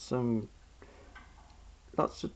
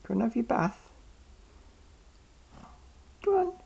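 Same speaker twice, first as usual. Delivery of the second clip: pet coaxing voice; 11 semitones higher, faster than usual. Real, not acted.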